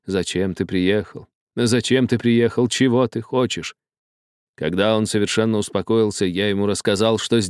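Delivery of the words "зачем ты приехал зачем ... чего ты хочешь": ordinary delivery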